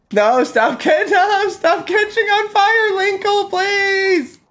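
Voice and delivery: high laughing begging; high frantic plea. Real, not acted. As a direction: beg through laughter to stop burning. Pleading with burning character.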